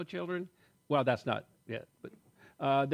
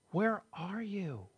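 slow, soft voice of God calling; 5 semitones higher, 5 dB quieter, much slower than usual. Acted. God calling to hiding Adam.

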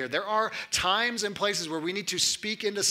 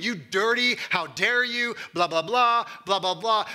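higher, louder condemning rant; mock angry tirade; 5 semitones higher, 5 dB louder than usual. Preacher mimics scolding an adulterous colleague, loud and high. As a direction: come in with guns blazing at him. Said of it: "Rather than coming with guns blazing".

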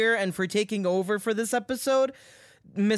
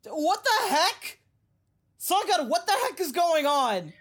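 indignant; 7 semitones higher than usual. Acted. Walks in on replacement, indignant.

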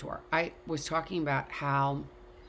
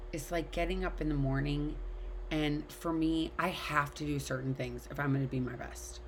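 ordinary delivery

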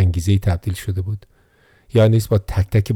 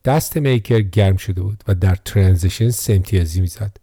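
ordinary delivery, nothing marked

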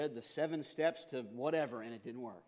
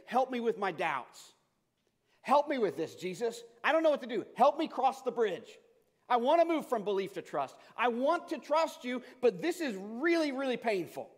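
desperate pleading; 7 semitones higher, 8 dB louder than usual. A narrator's reading. Father crying out to Jesus for his suffering son, loud and desperate. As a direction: beg with every bit of desperation. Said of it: "he cried out with every bit of emotion".

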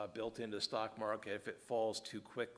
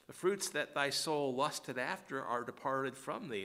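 higher, measured King James line; solemn scripture quote; 4 semitones higher than usual. Acted. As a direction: set the verse apart with weight. Quotes the verse again.

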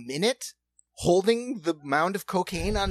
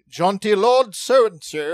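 put-on slow, louder jaunty lord accent; posh English lord voice; 5 semitones higher, 9 dB louder, slower than usual. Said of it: "he starts doing his".

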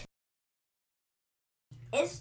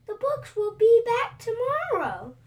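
reassuring Molly; 11 semitones higher, 7 dB louder than usual. A narrator's reading. Molly comforting dragon.

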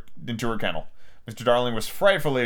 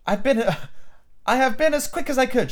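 put-on high girl's voice, a touch hurried; mildly acted out-of-breath child, high; panting; 9 semitones higher than usual. A narrator's reading. Daughter rushes in with the medicine, lightly panting. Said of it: "she panted".

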